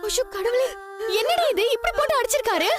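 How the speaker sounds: high-pitched, distressed shock; horrified dismay. Acted. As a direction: cry out at seeing her injuries. Sees the injuries on Sita. (அதிர்ச்சியில் பதறும் குரல்)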